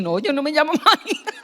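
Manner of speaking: laughing through a retold denial; giggling mid-sentence. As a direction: chuckle as you deny the name.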